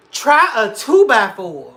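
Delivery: loud, slow, punched line; loud slow topper; emphatic. Real, not acted. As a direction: hit it hard and slow. Topping the story loudly.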